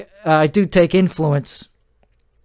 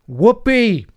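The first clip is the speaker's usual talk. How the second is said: sarcastic cheer; 7 semitones higher than usual. Real, not acted. Sarcastic cheer about buying dash cam.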